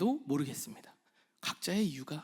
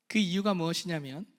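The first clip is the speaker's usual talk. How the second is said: usual speaking voice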